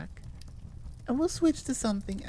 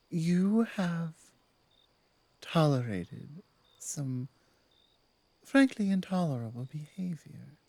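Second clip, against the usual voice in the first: apologetic werewolf pack leader; 4 semitones lower than usual. Acted.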